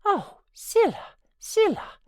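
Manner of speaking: Nelly gasps at news of the master's death; gasping in alarm. A narrator's reading. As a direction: cry out in sudden dread. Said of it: "I gasped".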